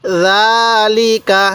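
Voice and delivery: জালিকা read very slowly, the first vowel of জা held one alif long; slow drawn-out recitation. Shown as a demonstration. Teacher reciting জালিকা to students, slowly drawn out. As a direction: stretch জালিকা, holding জা and keeping লি and কা short. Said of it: "এরকমভাবে শিখব".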